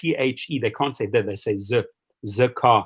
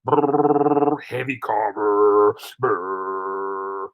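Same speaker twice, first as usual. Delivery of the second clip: engine noise; 6 semitones lower, much slower than usual. Retold or imitated. Imitating the heavy car engine.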